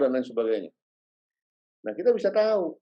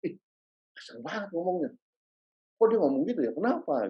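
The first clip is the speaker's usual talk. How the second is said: shocked disapproval; 5 semitones higher, 4 dB quieter than usual. Retold or imitated. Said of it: "orang akan langsung bertanya-tanya".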